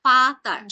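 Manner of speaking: ফাতার with a clear final আর; model pronunciation. Shown as a demonstration. Teacher models ফাতার's ending for students. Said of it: "আয়ের মতো উচ্চারণ হবে".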